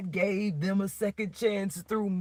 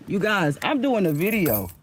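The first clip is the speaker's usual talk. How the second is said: louder call to the street; 3 semitones higher, 7 dB louder than usual. Real, not acted. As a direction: call out so the police hear. Calling out to police nearby.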